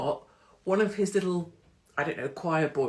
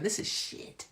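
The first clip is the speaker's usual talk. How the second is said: voiceless, softer grumble; muttered complaint; 4 semitones higher, 6 dB quieter, slower than usual. Retold or imitated. Gloomy Helsinki walker, voiceless mutter. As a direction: mutter it to yourself. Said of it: "Walking around Helsinki going".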